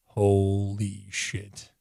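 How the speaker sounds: very slow, drawn-out amazement; stunned, drawn out. Acted. Reviewer imagining his own reaction to Transformers, slowly stunned. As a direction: stretch each word in stunned awe.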